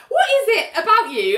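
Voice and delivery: high-pitched exasperated challenge; indignant. Retold or imitated. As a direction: demand an answer from a know-it-all, voice shooting up. Indignant reaction to the mansplainer.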